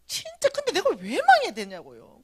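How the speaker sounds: loud, high-pitched aggrieved complaint; indignant protest. Retold or imitated. Good-living believer asking why she failed, indignant. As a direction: protest it hotly, as if wronged. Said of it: "이제 열받은 게 뭐냐면".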